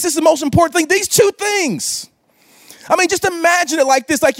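full-force preaching. Acted. Preaching at peak intensity.

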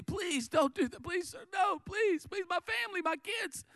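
high, frantic begging; desperate pleading. Acted. Servant begs his master to spare his family, desperate pleading. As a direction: plead in a high-pitched panic, words tumbling out.

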